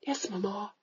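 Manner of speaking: soft, dutiful yes; hushed, meek reply; meek daughter. Retold or imitated. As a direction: mumble it like an obedient girl. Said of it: "she says".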